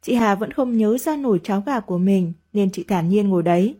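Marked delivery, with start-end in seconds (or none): none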